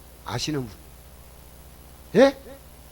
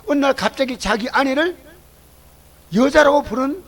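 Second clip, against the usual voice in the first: ordinary delivery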